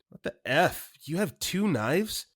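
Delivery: a touch of mock panic; alarmed disbelief. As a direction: squeak out the shock. Ted alarmed by two knives.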